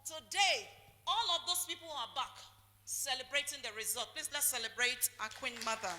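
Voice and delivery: loud, lifted celebration call; rousing call. Real, not acted. Preacher rallying church to celebrate Queen Mother, loud and exultant. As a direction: lift the room to celebrate her.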